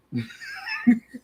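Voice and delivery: a sudden high burst of laughter; giggling high laugh. Real, not acted. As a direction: crack up at the story. Laughing at the retold fight.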